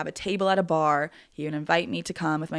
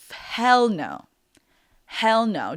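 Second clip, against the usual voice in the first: retold firm refusal, louder and higher; emphatic refusal; 5 semitones higher, 6 dB louder, much slower than usual. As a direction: quote his adamant veto, punched hard. Retelling his emphatic refusal.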